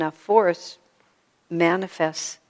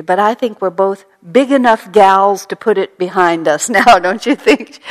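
loud, sweet, folksy country talk with a laugh; sweet country gal; 13 dB louder than usual. Retold or imitated. Dying Paula making peace with the rival, sweet country-gal voice. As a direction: coax her old rival like a friendly country gal. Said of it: "she said so sweetly".

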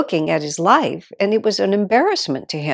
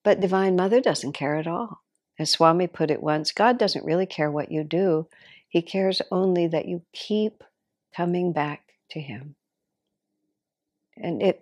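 ordinary talk, nothing marked

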